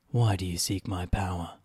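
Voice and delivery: lightly eerie spirit voice; a hint of a ghost speaking; ghostly voice. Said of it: "answered a ghostly voice".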